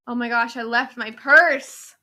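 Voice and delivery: sudden alarm at a forgotten purse; panicked. Retold or imitated. Panicked realizing purse left behind.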